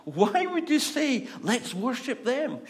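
high, loud, drawn-out disbelief; incredulous. Real, not acted. Preacher mocking the king's choice of gods, incredulous. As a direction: question it loudly as utterly absurd.

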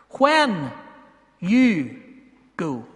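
"When", "you", "go" each alone, stepping down; word-by-word stress. Shown as a demonstration. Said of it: "notice a few of the words in that sentence".

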